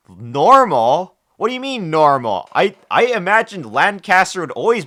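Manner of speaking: loud disbelieving rise on normal; can't believe what you just heard; incredulous outburst. Real, not acted. Shocked at being called normal.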